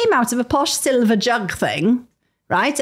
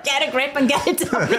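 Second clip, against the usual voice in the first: laughing; 5 semitones higher than usual. Real, not acted. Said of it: "We shan't be using that as a slogan".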